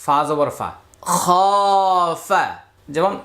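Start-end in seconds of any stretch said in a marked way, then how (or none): 1.1-2.4 খ held long, then a short ফা; madd stretch. Shown as a demonstration. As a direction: draw out the vowel of খ before ফা. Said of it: "একটি করে মাদের হরফ পাবো".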